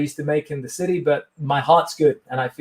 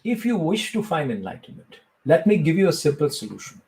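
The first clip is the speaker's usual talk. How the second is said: Indian-accented mock sage answering an interview question; Sadhguru impression. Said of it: "ask me a question as if I'm Sadhguru".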